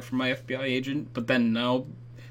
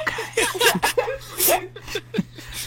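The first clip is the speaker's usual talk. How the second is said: giggly whispered laugh. Real, not acted. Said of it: "are we doing a whispering thing now?".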